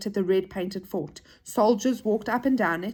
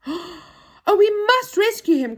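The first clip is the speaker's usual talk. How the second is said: high, loud, alarmed exclamation; urgent cry; 10 semitones higher, 6 dB louder, slower than usual. Acted. Joe learns Saucepan is locked up, urgent cry. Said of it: "cried Joe".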